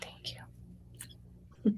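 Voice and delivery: breathless whispered thank you. Real, not acted. Moved author whispers thanks to the host, whispered. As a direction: barely voice it, overcome. Moved guest after hearing prayer.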